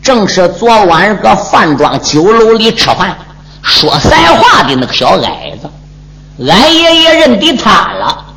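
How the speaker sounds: plain reading voice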